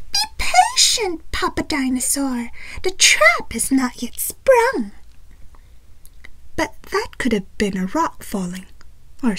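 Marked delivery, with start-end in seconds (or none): 0.1-4.9 high, sneaky dinosaur whisper; scheming dinosaur. A narrator's reading. Dinosaur telling Papa Dinosaur to wait, sneaky. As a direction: hiss the plan like a plotting monster.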